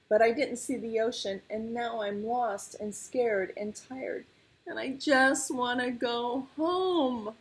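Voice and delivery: high, plaintive little tiger; lost cub about to cry; near tears. A narrator's reading. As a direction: build to a homesick wail, slowing on the last words. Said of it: "Sasha started to cry again".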